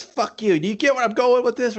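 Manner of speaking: high-pitched acted taunt; hammed-up defiance; mock defiant boast. Voicing the boasting villain.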